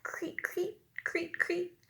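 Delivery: high soft whispered creak; crickets. A narrator's reading. Teacher imitates crickets in the grass, high and soft. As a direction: chirp it softly like crickets. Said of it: "The crickets go".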